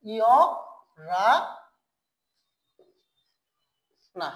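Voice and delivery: ইয় রা না in separate syllables, long gaps between; R sound in রা, no H; syllable-by-syllable model. Shown as a demonstration. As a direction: dictate ইয়, then রা, then না. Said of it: "এটার উচ্চারণটা কি হয়ে যাবে?".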